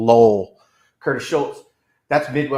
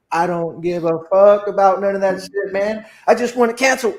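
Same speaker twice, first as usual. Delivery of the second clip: rising, louder angry rant at the agent; fed up retort; 6 semitones higher, 5 dB louder than usual. Retold or imitated. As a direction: vent at the rep, getting hotter. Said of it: "I'm like".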